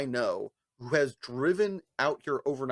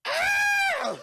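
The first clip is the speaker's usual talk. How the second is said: much higher, loud shriek of despair; anguished scream; 5 dB louder than usual. Retold or imitated. Watching friend misplay, hammed-up scream. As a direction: yelp like you can't watch. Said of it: "I'm just like".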